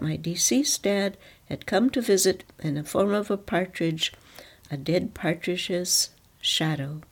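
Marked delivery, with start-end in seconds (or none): none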